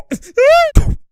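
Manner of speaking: high, loud burst of laughter; shrieking laugh. Real, not acted. Cracking up at a highlight, high shrieking laugh.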